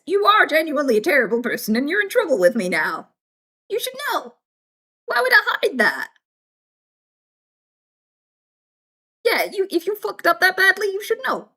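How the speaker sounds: indignant telling-off climbing high; mock lost temper. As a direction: let them have it, without shouting. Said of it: "if I've lost my temper with you".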